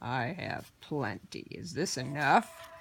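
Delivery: low and patient, a scheming goblin; horned goblin prodding quietly; sly goblin. Acted. Goblin offers gold.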